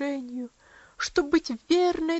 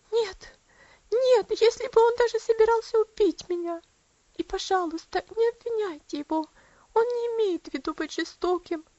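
higher, tearful and pleading, whispering the last ох; sobbing plea; 8 semitones higher, 5 dB louder than usual. A narrator's reading. Girl defending her father to her aunt, tearful and sobbing. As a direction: plead through sobs. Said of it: "всхлипнула девочка".

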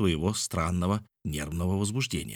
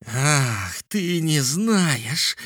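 high, drawn-out roar of outrage; indignant roar; 7 semitones higher, 4 dB louder, much slower than usual. A narrator's reading. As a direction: roar it slowly, stretching the words. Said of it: "взревел Мишель". (возмущённый рёв)

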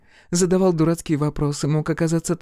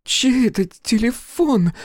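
slow, higher-pitched, upset question; voice faltering; 6 semitones higher, 3 dB louder, slower than usual. A narrator's reading. Fiona confronts Crow over the number on his hand, voice faltering. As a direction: demand it slowly as the voice wavers. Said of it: "Голос Фионы дрогнул".

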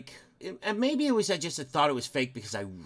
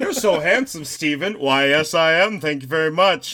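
self-flattering double act; 6 dB louder than usual. Acted. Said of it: "I could just talk to myself".

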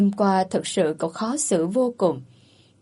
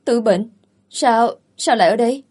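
louder, stammered surprise; taken aback; 3 semitones higher, 6 dB louder than usual. A narrator's reading. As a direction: blurt it out, stumbling on sao. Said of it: "con quýt chững hững". (giọng sững sờ, lắp bắp)

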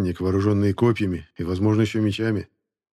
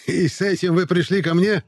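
shrill, piping voice, much higher; high squeaky voice; 9 semitones higher, 4 dB louder than usual. Acted. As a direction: squeak it out like a eunuch. Sermet's voice again.

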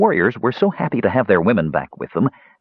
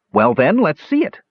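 higher, insistent demand; 7 semitones higher than usual. A narrator's reading. Tannen presses the stalling dwarf, demanding. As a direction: press him to produce it. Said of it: "Tannen demanded".

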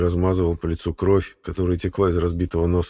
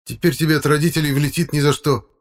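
put-on high-pitched, indignant boy; heated exclamation; 9 semitones higher, 4 dB louder than usual. A narrator's reading. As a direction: warn a friend with hot feeling. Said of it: "горячо воскликнул второй парень".

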